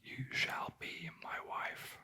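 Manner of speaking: ordinary delivery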